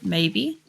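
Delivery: slow, uncertain "maybe" with a rising tune; questioning rise. Real, not acted. Streamer wondering aloud about planting in time, questioning rise on "maybe". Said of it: "I say maybe as a question".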